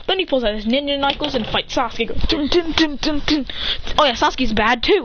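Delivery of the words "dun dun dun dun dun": higher, staccato "dun" hits; imitated fight noise. Knuckles fighting Sasuke, imitated impact noises. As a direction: voice the blows as sound effects.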